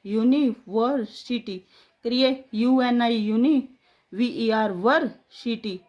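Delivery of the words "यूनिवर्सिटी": यूनिवर्सिटी drawn out slowly, each part clear; careful teaching pace; slow syllable demo. Shown as a demonstration. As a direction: say यूनिवर्सिटी slowly in three parts. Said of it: "अपने तीन टुकड़े हुए हैं".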